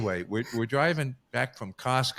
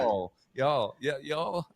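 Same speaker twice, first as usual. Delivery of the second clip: put-on trying Southern accent; 3 semitones higher, slower than usual. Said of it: "start putting that accent in there".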